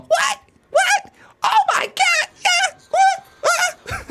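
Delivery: very high, loud squealing yelps; Con Man impression. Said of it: "You gotta raise your voice up high there".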